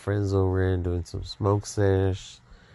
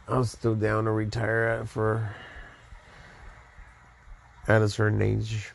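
drowsy, half asleep; nodding off; 4 dB quieter than usual. Real, not acted. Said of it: "Man, I'm falling asleep".

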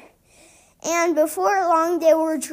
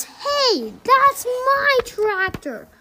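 higher, startled outburst; shocked realization; 7 semitones higher, slower than usual. A narrator's reading. Farmer Odell realizes the animals took his tractor, startled. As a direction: farmer suddenly sees his own tractor.

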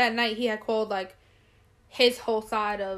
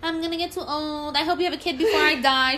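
raised, hammed-up lament; dramatic mother; 5 semitones higher, 3 dB louder than usual. Mom harassing her for a grandkid, hammed-up drama. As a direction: imitate mom's melodramatic nagging. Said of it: "She's so freaking dramatic".

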